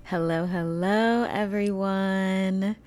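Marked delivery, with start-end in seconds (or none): none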